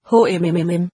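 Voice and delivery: a low, thoughtful hmmm; musing hum. A narrator's reading. Master sizing up the boy, low musing hum.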